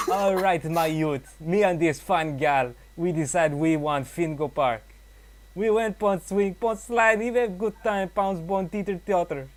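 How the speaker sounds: put-on loud, high Jamaican accent. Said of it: "I'll give it a try".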